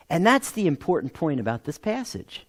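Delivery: normal talking voice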